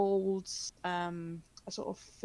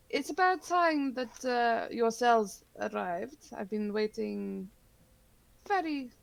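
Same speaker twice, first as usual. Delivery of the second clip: lightly voiced New Orleans psychic greeting her guests; self-admitted bad New Orleans accent; 3 semitones higher than usual. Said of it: "It's supposed to be a New Orleansian accent".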